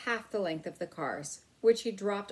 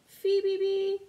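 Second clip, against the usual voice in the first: high whistled bird call; whistled birdcall; 12 semitones higher, 5 dB louder than usual. A narrator's reading. Narrator imitating a chickadee's whistled call. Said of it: "a chickadee whistled".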